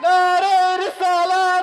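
high, drawn-out rallying cry; slogan call. Real, not acted. Preacher leads congregation in takbir, high rallying call. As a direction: lead the crowd with a stretched chant.

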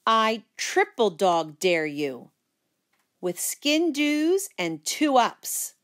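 very slow, drawn-out taunt; sneering dare. Acted. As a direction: sneer the ultimate dare word by word. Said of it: "I sneered".

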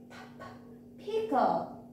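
bare puffed p, then the whole word "pickle"; isolated p sound. Shown as a demonstration. Teacher showing children the first sound, isolated p. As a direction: sound out the p before saying "pickle". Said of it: "all of these has p sound".

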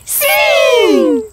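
excited shout. Acted. Eager answer to grandpa.

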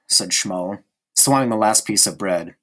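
ordinary delivery

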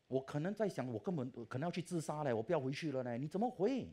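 normal talking voice

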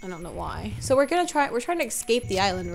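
ordinary talk, nothing marked

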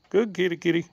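lightly high praise for the cat. Real, not acted.

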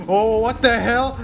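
loud, high shocked cry; shocked outburst. Real, not acted. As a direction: blurt it out in total disbelief. Sudden shock in the game.

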